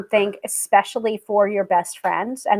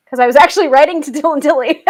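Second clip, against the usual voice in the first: real laugh breaking into the words; loud, giggly admission; amused confession; 6 semitones higher, 7 dB louder, faster than usual. Real, not acted. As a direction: confess it quickly, laughing, pitched up.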